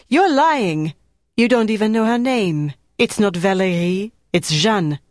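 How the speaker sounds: high and loud, then settling down; young resistance woman calling out an impostor; angry accusation. Acted. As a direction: hurl it at her, then drive the proof home. Marie exposes Stephanie's lie.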